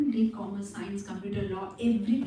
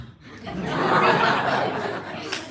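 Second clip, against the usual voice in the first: breathy laugh; 3 semitones lower, 6 dB louder than usual. Real, not acted. Speaker laughs at punchline.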